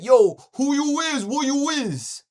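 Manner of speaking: exaggerated caricature of a belligerent guy; mocking street voice. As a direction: ham up a tough kid squaring off. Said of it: "He was like".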